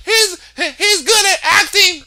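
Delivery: high, slow mock-hysterical woman with a laugh. Retelling the victim's hysterical words, high mock-hysterical woman. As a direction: squeal it out like she's losing it, laughing. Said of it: "She was all hysterical".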